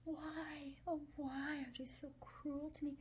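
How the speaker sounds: high, whiny mock lament in a breathy voice; mock self-pity. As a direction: play the wounded victim, lightly. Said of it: "we're not going to go".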